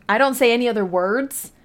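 ordinary delivery

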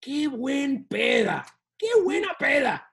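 slow, drawn-out mock party shout; thrilled party cheer. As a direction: cheer it slowly like the party is banging. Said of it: "it is the best party".

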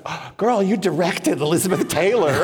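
high, hammed-up gushing, breaking into laughter; amazed friend's exclamation. Friend's amazed reaction, acted for laughs.

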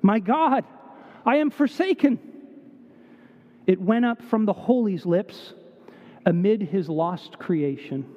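solemn recitation of Barrett Browning, softening to the end; poem recitation. Acted. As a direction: read the verse solemnly, letting it fade.

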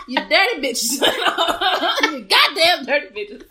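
mock scolding broken by laughter; laughing insult. Host mock-scolds dirty men, cracking up laughing. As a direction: scold through giggles. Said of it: "So I'm just like".

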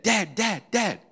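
high, loud repeated call. Retold or imitated. David turns to call his dad, calling out loudly. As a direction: imitate a son urgently calling for his father. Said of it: "he turns to call his dad".

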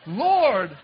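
high, loud startled "Lord"; shocked cry. Retold or imitated. Preacher shocked, crying out to God in alarm.

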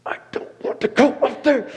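nervous laugh while refusing the pastor. Retold or imitated. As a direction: giggle out the protest.

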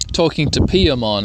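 normal talking voice

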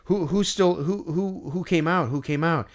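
mock breathless stammer; imitating a breathless kid. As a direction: stumble over the words like a winded kid. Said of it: "seemed like he was out of breath".

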